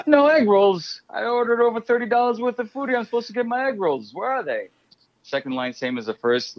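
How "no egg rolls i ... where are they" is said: much higher indignant customer complaint; whiny outraged customer wanting his egg rolls. Retold or imitated. Customer scolds delivery guy over missing egg rolls, high and whiny.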